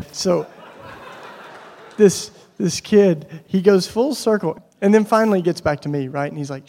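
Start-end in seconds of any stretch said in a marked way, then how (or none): none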